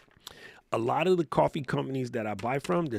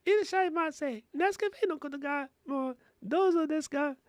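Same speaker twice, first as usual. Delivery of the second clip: much higher, sing-song Japanese shop welcome; high robot greeter voice. Retold or imitated. The robot welcoming the customer at the counter, high and robotic. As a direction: chirp the welcome like a polite little robot. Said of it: "it'd be like".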